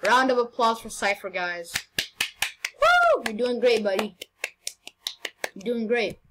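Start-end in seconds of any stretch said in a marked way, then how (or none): 2.8-3.2 very high mock cheer; fake-cheer the rival; sarcastic whoop; sarcastic woo.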